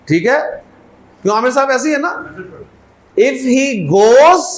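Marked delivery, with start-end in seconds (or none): 3.2-4.4 the clause drawn out slowly and louder; slow dictation. Shown as a demonstration. Teacher dictating the English sentence, slowly. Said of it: "comma लगा दें".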